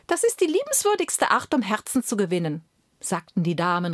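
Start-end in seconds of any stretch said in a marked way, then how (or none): 0.1-2.6 high, swooning admiration; gushing court ladies. Acted. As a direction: ladies cooing over the song. Court ladies voiced in story.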